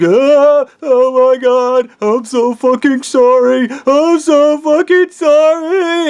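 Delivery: high, loud, drawn-out wail; acted sobbing breakdown. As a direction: wail the apology in hammed-up anguish. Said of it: "he breaks down, and he's all, like, crying".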